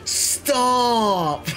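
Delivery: high, whiny please stop; high pleading. Real, not acted. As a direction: beg him to stop, high and playful.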